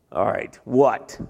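low, slow, grudging reply; a scowling God giving in; low and drawn out like a reluctant judge. Retold or imitated.

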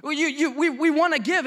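loud, high, stammering eagerness; urgent pleading. Acted. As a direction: plead it over and over, insistently. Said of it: "urgently pleaded with us".

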